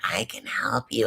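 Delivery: low, soft, knowing voice; Stephanie. Retold or imitated. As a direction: drop low and quiet as Stephanie offers help. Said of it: "Stephanie just being like".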